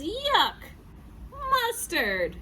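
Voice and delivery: high-pitched cry of disgust; disgusted dog. Acted. Dog bites a hot dog and tastes mustard, high disgust.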